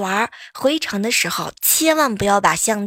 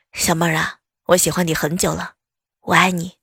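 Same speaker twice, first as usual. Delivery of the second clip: 低沉男声; 6 semitones lower than usual. Retold or imitated. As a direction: lower male classmate voice. 学男同学表白. (压低声音扮男同学表白)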